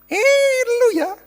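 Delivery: put-on a father tweaking a child's ear, high and teasing; scolding parent. Father scolding a child while pulling his ear, high mock scold.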